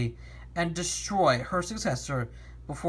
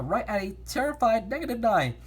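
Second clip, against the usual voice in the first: usual speaking voice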